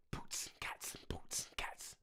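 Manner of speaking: unvoiced-style whispered drum beat; beatbox it softly under the breath; hushed, percussive beatboxing. Shown as a demonstration. Beatboxing a boom bap beat.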